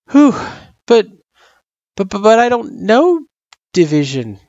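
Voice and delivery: plain reading voice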